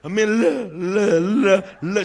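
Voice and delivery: stammering hums; stuttering. Retold or imitated. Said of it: "him say".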